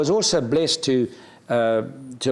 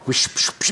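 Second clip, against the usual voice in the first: whooshing hip noise. Retold or imitated. Imitating hip swing sound.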